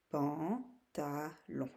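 "pantalon" slowly, a gap between syllables. Shown as a demonstration. Teacher showing the three syllables needed, syllable by syllable. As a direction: say "pan", "ta", "lon" separately. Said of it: "tu as besoin de trois syllabes".

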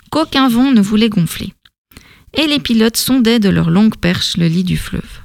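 ordinary delivery, nothing marked

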